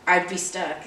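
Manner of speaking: ordinary delivery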